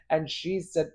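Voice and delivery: ordinary talk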